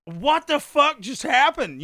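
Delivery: slow, high, loud question; mock stunned disbelief. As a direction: voice the whole room's bewilderment. Voicing stunned party guests.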